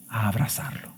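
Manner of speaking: whispered softly; tender whisper. Acted. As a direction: breathe it like a tender secret. Preacher contrasts kneeling and embracing.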